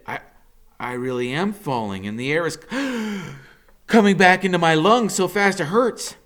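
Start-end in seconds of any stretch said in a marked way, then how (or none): 2.6-3.3 sharp gasp for air. A narrator's reading. Air returns to lungs.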